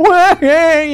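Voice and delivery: high mock crying wail; imitated canine whine; whining dog. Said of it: "just whining and crying".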